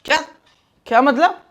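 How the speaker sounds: loud, high startled question; चौंककर पूछना. Acted. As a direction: blurt it out, taken aback. Shocked the maid is dismissed. (चौंककर, ऊँची आवाज़ में)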